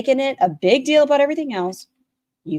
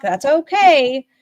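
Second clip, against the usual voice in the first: slow, louder, stretched-out reassurance; drawn-out reassurance; 5 semitones higher, 7 dB louder, much slower than usual. Real, not acted. Host reassures the streamer, slow and stretched. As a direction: stretch it out to calm her.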